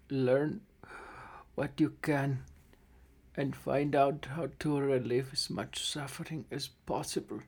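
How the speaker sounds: a lightly voiced old gnome, soft and fading, with coughs; dying old gnome. Dying gnome father says farewell to his construct, soft and failing. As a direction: give weak last words, trailing away.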